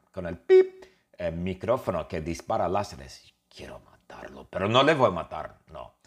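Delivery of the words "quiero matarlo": soft sinister whisper; conspiratorial whisper. Acted. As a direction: hiss it like a secret threat. Menacing secret aside.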